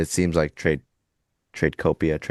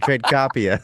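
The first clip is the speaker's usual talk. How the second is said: put-on Boston accent; 4 semitones higher than usual. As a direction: Trade Copia in a Boston accent, "Copia" with an open broad ah. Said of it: "everyone says in the Boston accent".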